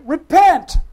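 crying out. Acted. Preacher quotes John the Baptist's mission statement, crying out. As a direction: call it out like a street prophet. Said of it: "He was to cry".

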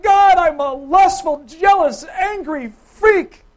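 loud, high, drawn-out outburst; mock confession. Preacher acts confessing to God, exaggerated loud wail. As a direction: blurt out your sins, slow and hammed up.

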